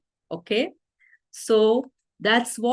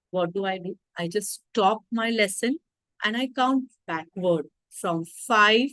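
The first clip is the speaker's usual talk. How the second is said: normal talking voice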